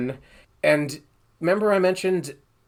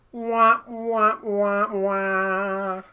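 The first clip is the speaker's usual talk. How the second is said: sad trombone wah; 7 semitones higher, much slower than usual. Retold or imitated. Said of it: "all I hear is".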